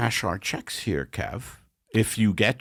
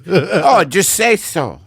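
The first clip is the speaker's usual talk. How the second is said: excited; 9 semitones higher, 10 dB louder than usual. Pretend. Mock waiter reacts loudly.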